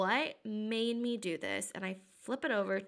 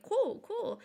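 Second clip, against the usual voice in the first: mock cheery; 11 semitones higher than usual. Fake-cheerful reply to boyfriend.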